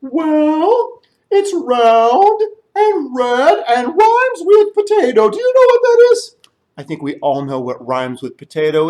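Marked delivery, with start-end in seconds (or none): 0.0-6.4 high, loud sniffing excitement; excited elephant. Acted. Elephant smelling the soup, loud and eager.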